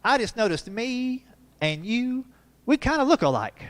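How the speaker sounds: put-on a curious kid noticing something; small boy at the mirror; little boy voice. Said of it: "He said".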